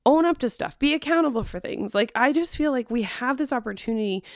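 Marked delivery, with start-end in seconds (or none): none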